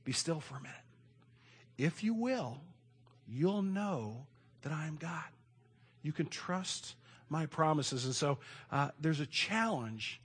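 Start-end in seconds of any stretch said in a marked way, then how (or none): 0.0-8.0 a soft, slow, lightly voiced God; God's tender call to Abram, softly; God speaking gently. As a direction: coax him quietly to be still. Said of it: "that's what God's saying to Abram".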